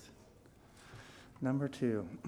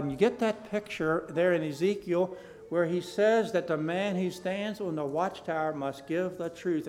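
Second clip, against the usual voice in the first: ordinary delivery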